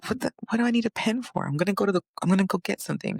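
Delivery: baffled at being told to take a pen; confused self. Retold or imitated. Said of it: "And I'll be like".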